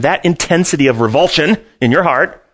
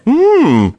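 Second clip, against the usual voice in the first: high, loud pondering hmm; a loud hmm of interest; thinking hmm; 12 semitones higher, 5 dB louder than usual. Real, not acted. Reacting to question.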